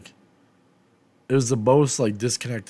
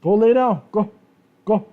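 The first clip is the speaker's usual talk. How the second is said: loud and much higher, ordering the dogs to lie down; shouting at dogs; 9 semitones higher, 6 dB louder than usual. Real, not acted. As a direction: yell it across the room so the dogs obey.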